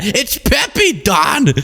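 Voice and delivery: high-pitched exasperated imitation; mock scream. Aide correcting the president like an exasperated relative, mock scream. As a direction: screech it up high, without real volume. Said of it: "it's like that".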